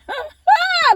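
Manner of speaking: burst of laughter. Real, not acted. Laughing mid-testimony.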